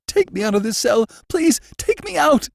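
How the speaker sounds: high, loud, desperate begging; terrified pleading. A narrator's reading. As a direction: beg for your life to be moved. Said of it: "he pleaded".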